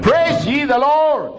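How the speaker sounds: high, slow ringing praise; praise call. Acted. Pastor calling the church to praise, high ringing call. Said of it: "repeated praise call".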